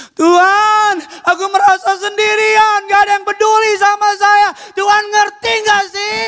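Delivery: high, loud desperate plea with a sob; a lonely voice begging heaven; crying out to God. Acted. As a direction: cry out as if abandoned by everyone. Preacher voices a despairing prayer.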